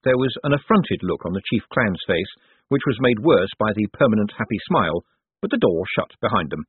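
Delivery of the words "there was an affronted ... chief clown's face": usual speaking voice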